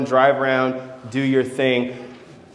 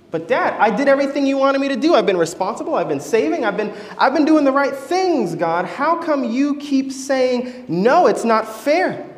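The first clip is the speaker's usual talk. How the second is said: high, fast whiny protest, pitch climbing; a teen pleading it isn't fair; upset teen complaint; 7 semitones higher than usual. Acted. Son protesting to his father, high and whiny.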